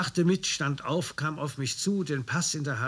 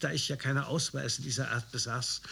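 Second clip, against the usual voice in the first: ordinary delivery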